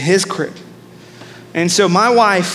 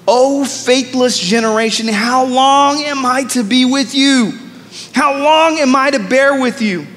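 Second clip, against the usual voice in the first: preaching scripture; 4 semitones higher than usual. Acted. Reading Jesus' words.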